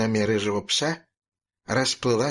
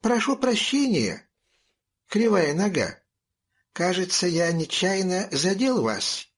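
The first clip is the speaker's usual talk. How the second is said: exaggeratedly polite, raised high; overly courteous, sugary apology; 6 semitones higher than usual. A narrator's reading. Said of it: "очень вежливо сказал". (подчёркнуто вежливо)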